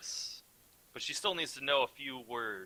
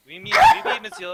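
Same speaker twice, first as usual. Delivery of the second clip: high, loud mock-French maid; French maid accent; 15 dB louder than usual. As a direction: squeal it in a silly French accent. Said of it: "I wanted to do French maid".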